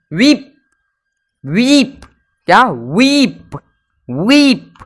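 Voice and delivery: teacher modelling वीप with a long ee. Shown as a demonstration. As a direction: hold the ee in वीप. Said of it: "वीप मतलब होता है रोना".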